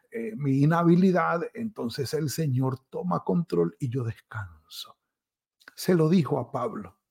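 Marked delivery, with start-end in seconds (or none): none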